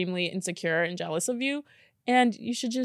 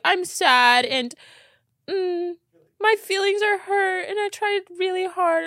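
higher, whiny sulk; mock sad man; 9 semitones higher than usual. Imitating a man seeking pity, whiny higher voice. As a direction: mimic a sad man whining. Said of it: "A man's like".

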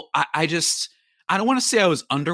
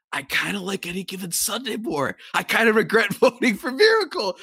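laughing confession; 5 semitones higher than usual. Retold or imitated. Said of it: "I was like".

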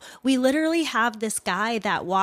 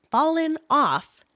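sarcastic; 7 semitones higher, 5 dB louder, slower than usual. Acted. Sarcastic quote of officers' excuse.

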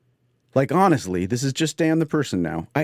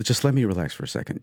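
usual speaking voice

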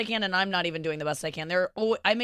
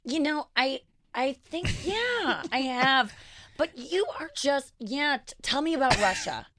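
higher, hammed-up mom voice; mom impression; 5 semitones higher than usual. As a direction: play your mother chatting. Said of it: "talk as our moms".

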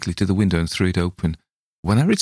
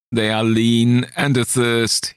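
higher, slow shrieking dread; 5 semitones higher, slower than usual. Acted. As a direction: cry out the warning. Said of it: "he shrieked".

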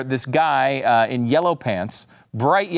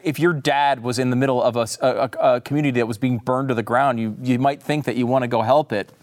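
ordinary delivery

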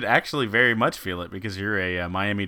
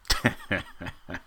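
real chuckle at a pun; amused laugh. Real, not acted. Cohost reacting to the Hootie pun, amused laugh.

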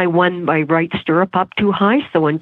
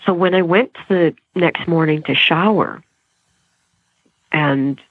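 usual speaking voice

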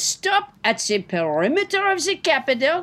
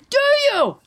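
pitched up insistent question; high shrill demand; 10 semitones higher, 3 dB louder than usual. Acted. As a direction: push it higher and sharper. Escalating demand.

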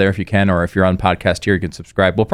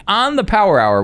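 usual speaking voice

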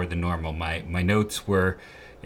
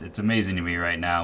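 ordinary talk

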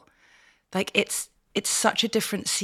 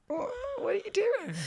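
put-on confused old man; 6 dB quieter than usual.

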